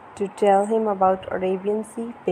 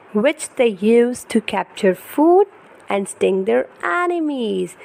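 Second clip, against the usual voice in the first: put-on lively, sing-song storybook reading for a toddler; reading to children; 3 semitones higher, 3 dB louder, slower than usual. Parent reading an ocean picture book to baby, animated storytelling. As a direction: read it brightly to hold a little one's attention.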